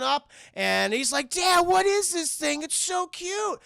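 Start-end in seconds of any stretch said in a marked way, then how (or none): 1.3-3.6 put-on high, slow gushing boy voice; hammed-up cute-overload delight; thrilled kid. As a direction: imitate a son melting over a pet. Son thrilled by the creature.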